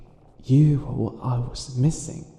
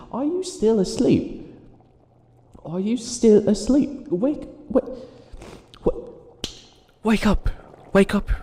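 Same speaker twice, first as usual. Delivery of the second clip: high, loud shout to rouse a sleeper; loud wake-up call; 9 semitones higher, 4 dB louder than usual. Acted. Villain shouting at his sleeping captive to wake up, loud and high. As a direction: yell them awake, getting more urgent.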